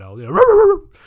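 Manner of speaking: loud high barking cry; barking yelp. Retold or imitated. Pranking his wife with a loud high bark.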